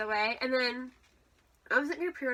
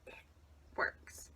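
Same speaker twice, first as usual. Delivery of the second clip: whispering; 11 dB quieter than usual. Real, not acted. Whispered punchline.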